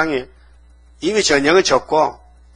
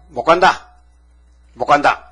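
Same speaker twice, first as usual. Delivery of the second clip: scoffing refusal; 3 dB louder than usual. Acted. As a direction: jeer him down as a foolish boy. Townspeople scoff at David.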